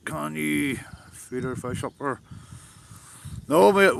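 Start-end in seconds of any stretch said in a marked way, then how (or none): 3.5-4.0 louder, higher burst of no; emphatic no. Real, not acted. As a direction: shoot the question down hard. Reacting to a chat question.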